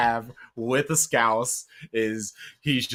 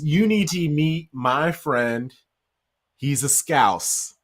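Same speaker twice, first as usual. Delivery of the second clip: slow reveal; 5 semitones higher, 3 dB louder, slower than usual. Retold or imitated. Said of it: "And he goes".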